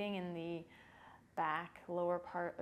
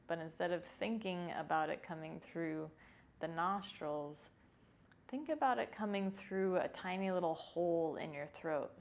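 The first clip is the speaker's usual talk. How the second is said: usual speaking voice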